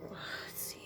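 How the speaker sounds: whisper. Real, not acted. Overwhelmed reader whispering.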